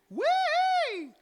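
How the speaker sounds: very high, loud Wee! up in the head. Shown as a demonstration. Coach squeals a head-voice Wee!. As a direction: let it ring behind the skull. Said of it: "There's your head voice".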